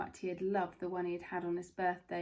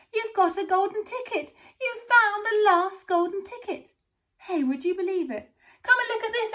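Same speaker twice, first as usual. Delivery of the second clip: high, loud excited scream; 11 semitones higher, 11 dB louder than usual. A narrator's reading. Shopkeeper finds golden ticket in his shop, screaming in delight. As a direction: shopkeeper screams with sudden joy. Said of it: "screamed the shopkeeper".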